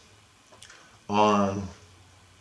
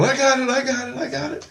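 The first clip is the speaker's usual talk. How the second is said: high, strained voice; Jesus straining. Retold or imitated. Jesus hauling the car through the dreamer, high strained. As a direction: grunt it out while lifting.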